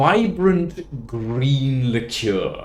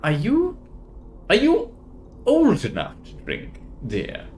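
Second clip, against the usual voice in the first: high, rising, doubtful questioning; a barmaid peering down at a tiny customer; 11 semitones higher than usual. Acted. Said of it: "scratching her head and going".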